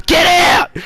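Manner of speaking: very high, loud retold shout; imitated yell. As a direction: yell it out, imitating an angry stranger. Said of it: "like, she yelled at me".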